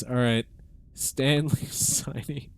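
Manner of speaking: ordinary talk, nothing marked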